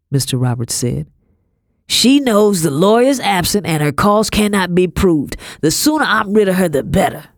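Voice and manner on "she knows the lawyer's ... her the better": higher, louder scornful gentleman; irritated slave master. A narrator's reading. Master wanting rid of the girl, irritated. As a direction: make the case angrily and dismiss the girl.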